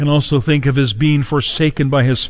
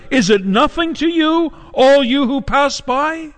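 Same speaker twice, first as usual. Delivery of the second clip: high-pitched, drawn-out outcry to passers-by; anguished cry; 10 semitones higher, slower than usual. Acted. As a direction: cry it out high, as a pleading question. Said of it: "at the top of her lungs, she cries out".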